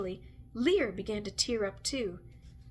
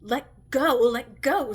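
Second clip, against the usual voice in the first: put-on loud, pressing "let go" at a clinging child; forceful pleading shout; urgent shout; 2 semitones higher, 9 dB louder, slower than usual. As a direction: push her off with your voice. Witch fends off clinging child.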